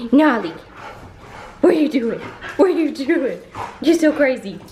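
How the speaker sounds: high, loud playful pet talk; doggy talk. Real, not acted. Owner playfully scolding her dog Nolly, high and loud. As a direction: gush over the dog.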